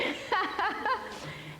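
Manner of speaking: short high-pitched laugh. Real, not acted. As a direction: laugh at your own admission. Laughs at own joke about eating.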